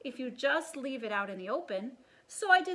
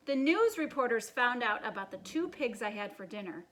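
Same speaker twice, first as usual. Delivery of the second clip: usual speaking voice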